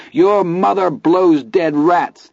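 slow, high furious scream. Acted. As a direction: shriek the insult slowly at him. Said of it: "Teddy screamed".

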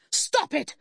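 high-pitched furious outburst; angry shout. A narrator's reading. Enraged mother rejecting sympathy, shouting. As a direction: explode sharply, pitched very high. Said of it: "she shouted".

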